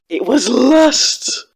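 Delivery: loud, high, drawn-out echo; hammed-up, slow dramatic line reading; theatrical mimicry. Viewer repeating the courtroom outburst, hammed-up imitation.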